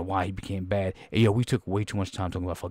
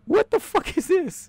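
laughing; slower than usual. Real, not acted. Laughing at the movie.

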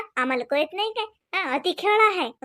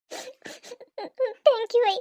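laughing thanks; 6 semitones higher, much slower than usual. Acted. Relieved thanks.

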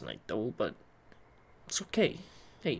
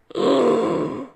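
startled gasp; 6 semitones higher, 8 dB louder than usual. Acted. Sudden discovery.

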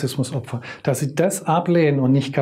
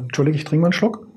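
ordinary delivery

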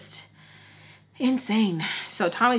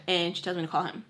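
ordinary delivery

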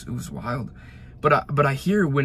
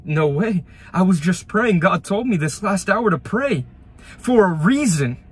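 retold amazement; 5 semitones higher, 5 dB louder than usual. Retold disbelief after the crash.

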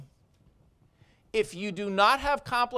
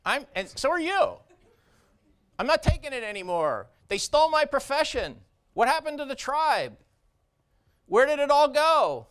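passionate rant; 5 semitones higher than usual. Real, not acted. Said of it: "which you might hear in my voice".

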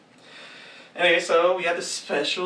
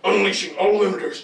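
robot announcer voice; 4 dB louder than usual. Shown as a demonstration. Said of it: "Just do it without the funny".

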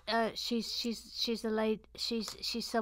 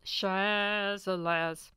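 SHAZALAZ stretched out slowly; drawn-out nickname; 3 semitones lower, much slower than usual. Pretend. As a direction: linger playfully on each vowel of Shazalaz.

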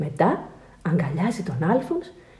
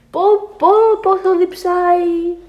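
exaggerated thirst; 9 semitones higher, 4 dB louder than usual. A narrator's reading. Dramatizing Alfons's terrible thirst.

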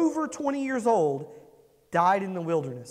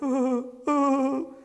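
higher whimpering mock sob; mock crying; 5 semitones higher than usual. Comedian's daughter whimpers in the back seat, mock crying. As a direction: imitate a whiny kid crying. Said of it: "my daughter in the back seat crying".

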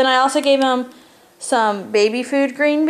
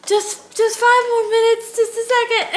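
lightly voiced sleepy mom, higher pitch; mock pleading for more sleep; sleepy mumble; 10 semitones higher than usual. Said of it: "And I was like".

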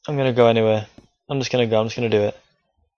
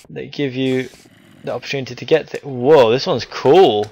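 ordinary talk

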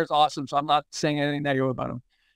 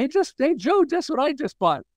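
excited call; 9 semitones higher than usual. Retold or imitated. Calling friend to share purchase.

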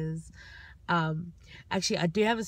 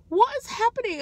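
high, loud alarmed question; shocked high squeal; 7 dB louder than usual. Retold or imitated. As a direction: squeal it in disbelief. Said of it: "And she's like".

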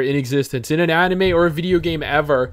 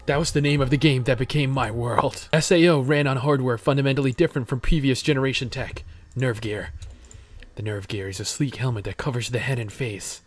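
soft, lowered, serious narrator voice; story narration; 4 semitones lower, 6 dB quieter than usual. Acted. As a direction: quietly recount the lore like a prologue. Reads story narration aloud.

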